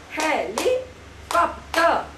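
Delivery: "Helicopter" very slowly in four separate beats; syllable by syllable. Shown as a demonstration. Teacher tells child to listen, syllable by syllable. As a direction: spell out "Helicopter" by sound, gaps between syllables. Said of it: "Mouth closed".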